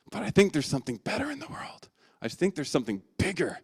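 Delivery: unvoiced, awed whisper. Younger son dreams beyond the farm, hushed whisper. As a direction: confide a big dream in a whisper.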